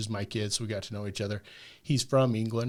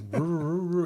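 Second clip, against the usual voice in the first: rolling, higher-pitched engine whirr; imitated car noise; 7 semitones higher, 4 dB louder than usual. Caller mimicking car trouble for mechanic dad, imitated engine noise. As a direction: mimic a faulty car's rumbling noise. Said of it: "What does it sound? Is it a".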